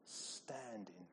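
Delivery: soft, near-whispered just standing; quiet. Shown as a demonstration. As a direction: let it drop to a hush. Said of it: "Doesn't even sound like they're attacking".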